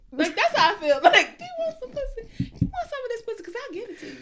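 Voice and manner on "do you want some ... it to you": high, sultry offer with a giggle. Pretend. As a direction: tease it out high and inviting. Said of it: "my pussy sounds like [name]".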